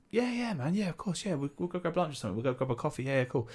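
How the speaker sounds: high, soft, easy yeses; airy, high-pitched mock keenness; breezy fake agreement. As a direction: imitate agreeing just to be polite. Insincere agreement acted out.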